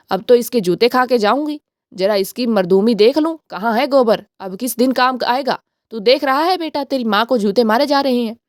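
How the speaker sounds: usual speaking voice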